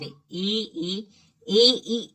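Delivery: teacher modelling ई from the palate; palatal sound demo. Shown as a demonstration. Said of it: "तालु, यानी तालब".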